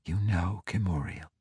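soft, hushed breathy whisper. A narrator's reading. Ivanel whispers to the prisoner over the scrying pool. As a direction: hush it as if not to break the spell. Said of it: "Ivanel whispered".

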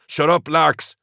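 high, loud warning bark; sharp warning. A narrator's reading. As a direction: cut him off hard. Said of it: "warned Corbeck".

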